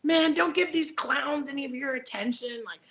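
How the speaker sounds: a hint of a dismissive big friend; Hulk. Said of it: "Hulk the story, and he's like".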